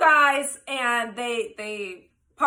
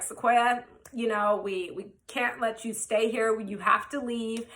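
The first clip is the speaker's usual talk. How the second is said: low, soft officer voice; imitating the cops; 5 semitones lower, 6 dB quieter than usual. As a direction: mimic an officer quietly reasoning with someone. Imitating cops asking her to leave.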